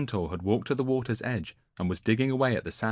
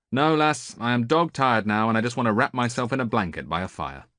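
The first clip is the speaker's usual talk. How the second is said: louder, quicker, a gruff-sounding tired king; weary old sailor; 4 semitones higher, 5 dB louder than usual. A narrator's reading. Odysseus refusing to walk her back, tired and blunt. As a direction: brush her off, worn out and blunt.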